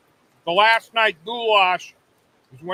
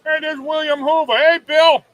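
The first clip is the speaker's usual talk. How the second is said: loud, high greeting across; calling out; 8 semitones higher, 5 dB louder than usual. Real, not acted. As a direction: call out to a friend. Calling out to passerby.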